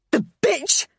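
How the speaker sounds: high, loud furious scream. Acted. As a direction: spit it out in rage. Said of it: "I screamed".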